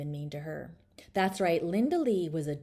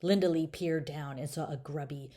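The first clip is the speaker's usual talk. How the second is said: ordinary delivery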